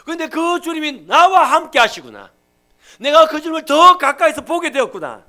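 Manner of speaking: ordinary talk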